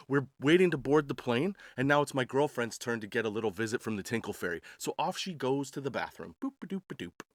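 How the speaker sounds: ordinary delivery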